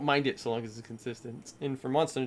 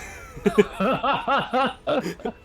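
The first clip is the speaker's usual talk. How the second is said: loud high laugh; 10 semitones higher, 7 dB louder than usual. Real, not acted. Big laugh after bonk.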